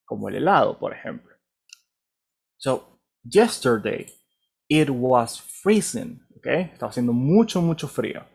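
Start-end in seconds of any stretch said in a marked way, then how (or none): 2.6-6.2 So, yesterday, it was freezing said slowly and clearly; slow model reading. Shown as a demonstration. Teacher models the line for students, slow and clear. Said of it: "freezing es una palabra muy común".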